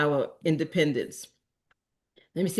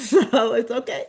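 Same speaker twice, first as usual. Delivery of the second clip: laughing; 6 semitones higher, 4 dB louder than usual. Real, not acted. Laughs off own awkward remark.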